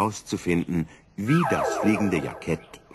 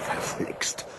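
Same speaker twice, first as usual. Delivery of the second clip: muttered curse; 8 dB quieter than usual. Acted. Fisherman-professor cursing the storm.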